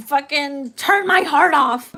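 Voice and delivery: hammed-up heartbroken declaration; dragging each word out in mock despair; mock desperate vow. Retold inner voice, mock desperate.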